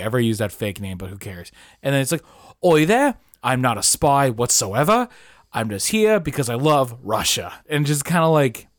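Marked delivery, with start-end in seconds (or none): 2.6-7.5 Russian soldier with a put-on British accent. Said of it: "Well, he's British".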